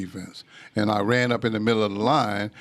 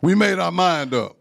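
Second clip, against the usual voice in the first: higher, louder stern declaration; stern father; 8 semitones higher, 4 dB louder than usual. Retold or imitated. Strict father ending the argument, stern declaration. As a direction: lay down the law as the dad. Stern WWII vet dad.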